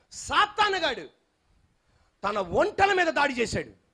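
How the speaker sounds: preacher listing Satan's attacks on Job; preaching. Acted. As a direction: build each line of the attack list. Preaching Job's story.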